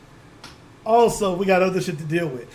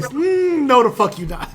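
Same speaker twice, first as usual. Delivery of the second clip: scoffing dismissal; 6 semitones higher, 4 dB louder, slower than usual. Retold or imitated. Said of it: "That's like pronouncing the S".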